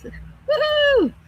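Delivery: very high held woohoo, falling at the end; sustained high-pitched woo; happy whoop. Real, not acted. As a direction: let out a high, drawn-out cheer. Cheers during livestream chat.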